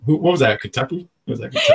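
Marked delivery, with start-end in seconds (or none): 1.3-1.8 squealing with laughter, much higher; host laughs at the cohost's accent; laughing question. Real, not acted. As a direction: crack up while asking.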